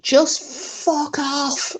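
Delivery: slow, high mock yell at the dark; retold shout. Man yelling into the woods at the unseen creature, mock shout. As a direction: drag the words out high as a half-hearted shout. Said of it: "but he basically shouted".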